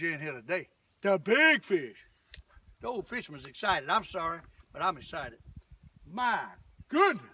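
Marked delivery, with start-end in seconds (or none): 1.0-2.0 louder, punchy repeat; booming announcement. Shown as a demonstration. 6.1-7.3 excited exclamation. Real, not acted. Fish coming in, sudden burst.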